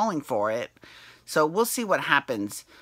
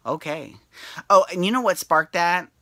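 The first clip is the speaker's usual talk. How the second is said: ordinary delivery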